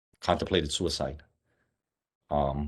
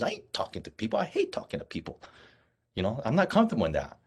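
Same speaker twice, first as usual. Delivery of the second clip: emphatic refusal; 6 semitones higher than usual. Retold or imitated. Retelling how he turned down the spokesman job, animated refusal. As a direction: toss it off like the job is a joke.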